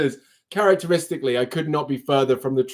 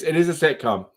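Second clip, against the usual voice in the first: normal talking voice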